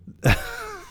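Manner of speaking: high burst of laughter. Real, not acted. Laughs at the vacuuming.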